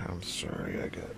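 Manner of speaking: hushed whisper; whispered apology. Shown as a demonstration. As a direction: drop to a bare whisper, as if not to be overheard.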